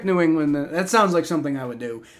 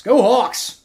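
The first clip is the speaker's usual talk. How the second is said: loud, higher cheer slipping into Brooklyn; Brooklyn accent; 6 semitones higher, 5 dB louder than usual. Shown as a demonstration. Streamer cheering on the Seahawks, accidental Brooklyn accent. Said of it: "that's a Brooklyn accent".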